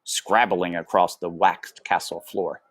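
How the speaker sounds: usual speaking voice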